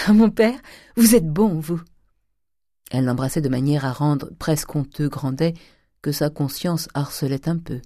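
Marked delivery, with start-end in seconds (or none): 0.1-1.8 louder, higher cry of thanks; joyful outburst. A narrator's reading. The daughter hugging her father, loud joyful cry. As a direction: burst out with delight. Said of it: "sauta d'un bond au cou".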